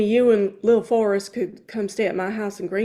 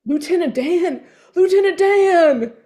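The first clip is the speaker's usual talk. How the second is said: calling out joyfully, rising louder; excited call; 9 semitones higher, 8 dB louder than usual. Acted. Forrest calls out to Lieutenant Dan, overjoyed and loud. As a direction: shout his name across the lawn, overjoyed.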